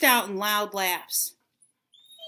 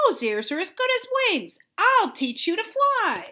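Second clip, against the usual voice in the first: excited; 9 semitones higher than usual. A narrator's reading. Mouse has an idea.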